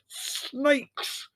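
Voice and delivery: high, strained snarl; furious one-word outburst. A narrator's reading. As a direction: spit it out in rage. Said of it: "snarled Leslie".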